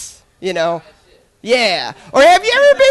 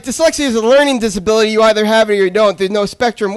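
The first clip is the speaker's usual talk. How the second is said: fast lecturing; faster than usual. Retold or imitated. Lecturing date in retold reply.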